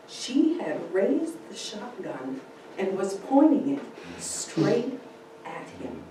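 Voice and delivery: plain reading voice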